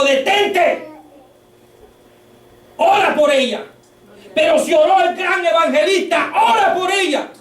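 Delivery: measured, rising preacher's intensity; emphatic preaching. Real, not acted. Preacher retelling God stopping him outside the hospital, emphatic preaching. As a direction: build each phrase toward a high, commanding peak.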